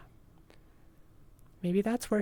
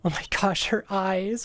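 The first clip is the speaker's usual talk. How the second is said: usual speaking voice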